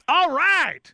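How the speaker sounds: high, loud whoop of delight; excited. Real, not acted. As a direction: cheer it out, thrilled. Host reacts to soap spoiler.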